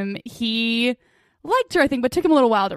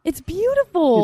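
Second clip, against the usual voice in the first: swooning; 6 semitones higher, slower than usual. Real, not acted. Swooning over scene.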